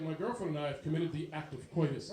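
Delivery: low, formal son voice; stiff nerdy son. Retold or imitated. Son calling his dad, low and overly proper.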